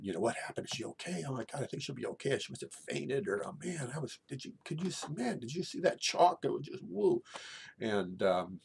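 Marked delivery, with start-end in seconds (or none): 0.2-3.3 put-on high, rapid, excited teenage babble; mile-a-minute chatter. Shaken student chattering about the fainting, mile a minute. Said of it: "now I'm just talking a mile a minute". 3.4-7.2 high, breakneck excited babble; mile-a-minute chatter. Retold or imitated. Student babbling to others about the chalk, mile a minute. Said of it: "now I'm just talking a mile a minute".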